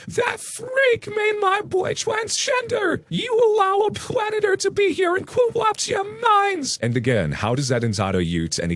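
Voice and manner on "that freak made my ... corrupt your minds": very high, slow falsetto Karen scream; hammed-up high-pitched furious mother. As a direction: shriek it up high like an outraged mother. Narrator voices Karen.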